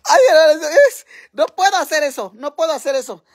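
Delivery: high, loud whiny wail; mock despair. Streamer unable to do the suffering face, mock despair. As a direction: wail as if giving up, hammed-up. Gives up on her suffering act, wailing.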